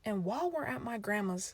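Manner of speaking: ordinary talk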